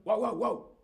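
high playful barking; dog barking. Shown as a demonstration. Teacher barking to show a ladrido. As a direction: yap it like an excited dog. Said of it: "se llama ladrido".

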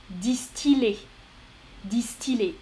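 distiller slowly, "dis-ti-lé"; ILL with L. Shown as a demonstration. Teacher shows the exception, distiller with L. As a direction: keep a clear L in distiller. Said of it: "se prononcent il".

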